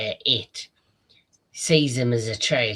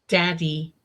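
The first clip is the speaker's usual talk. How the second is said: high, sweet imitation of the daughter; lightly voiced young girl; mock little-girl "Daddy"; 5 semitones higher than usual. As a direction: squeak out "Daddy" like a needy girl. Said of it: "And she's like".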